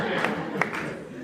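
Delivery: breathy, wheezing chuckle; airy laugh. Real, not acted. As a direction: laugh without voice. Laughs after boast.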